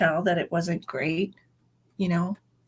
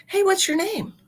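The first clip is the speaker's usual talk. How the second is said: put-on high, bright call to the boy; lightly voiced teen girl asking a name; daughter calling to boy; 12 semitones higher than usual.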